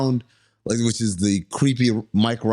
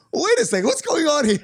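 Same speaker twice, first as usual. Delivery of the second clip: high, mock-alarmed questioning; loud suspicious friend; 13 semitones higher, faster than usual. Friend loudly questioning his sudden dog love, high. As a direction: call it out like you caught him. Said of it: "She said it loud as hell too".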